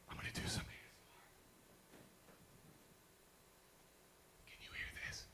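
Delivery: soft breathy ASMR whisper. Pretend. Host trying ASMR for the crowd, whispering. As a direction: whisper close to the mic.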